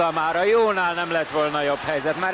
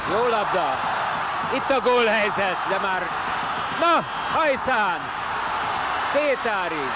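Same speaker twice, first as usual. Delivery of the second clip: higher, eager urging call; excited commentary; 7 semitones higher than usual. Real, not acted. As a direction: urge the striker on. Another goal chance.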